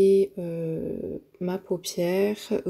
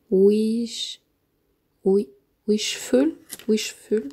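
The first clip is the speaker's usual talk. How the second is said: hesitant English word in a French accent; accent français en anglais; 3 semitones higher, 5 dB louder, much slower than usual. Real, not acted. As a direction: stumble over the English name with a French accent. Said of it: "Désolée pour mon accent". (accent français hésitant sur un mot anglais)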